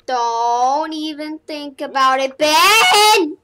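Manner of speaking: rising to a loud shout on the name; yelling at Ben. Real, not acted. Gamer yelling at Ben in the game, loud warning shout. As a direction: warn him off, then bellow his name.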